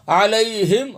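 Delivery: ordinary talk, nothing marked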